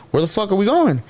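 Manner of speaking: a startled demand, pitched high; retold alarmed question. Cuffed unexpectedly, retold protest.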